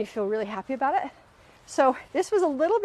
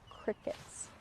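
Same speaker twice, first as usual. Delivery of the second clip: breathy near-silent whisper; whispered punchline; 16 dB quieter than usual. Real, not acted. Telling the audience her husband said nothing, whispered deadpan.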